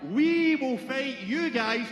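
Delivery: very slow, loud, every word spelled out. Shown as a demonstration. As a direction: break it down slowly for the foreigners. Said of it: "I'll break it down right slow".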